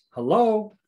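high, loud wake-up call; pointed hello. Real, not acted. Preacher to listeners after the warning, pointed loud hello.

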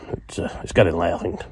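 ordinary talk, nothing marked

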